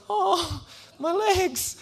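put-on high whiny kid nursing his legs; mock whimper. Hurt boy whines about his legs, high.

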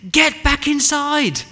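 high, loud, drawn-out yell; frantic yell. Acted. Mum to child stepping outside, yelling. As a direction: yell it slowly, high and full out. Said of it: "And she yelled".

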